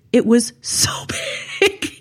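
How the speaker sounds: breathy laughing hush, stretched slowly; laughing whisper. Real, not acted. Host marveling to cohost, slow laughing whisper. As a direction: let it out slow, half laughing in awe. Emphatic awe at show's size.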